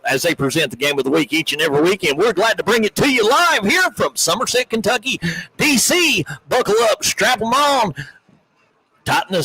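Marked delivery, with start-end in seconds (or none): 2.2-7.9 higher-pitched, rising hype announcer delivery; sportscast hype intro. Real, not acted. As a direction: pump up the listeners for kickoff. Hyping up the broadcast opening.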